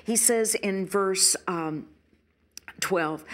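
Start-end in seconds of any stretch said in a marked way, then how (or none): none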